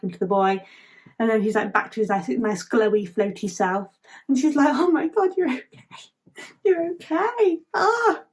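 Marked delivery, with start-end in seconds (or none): 4.7-8.2 high, gushing mock relief; overjoyed relief. Asher seeing the star is okay, acted joyful relief. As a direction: exclaim it with hammed-up relief, pitch soaring.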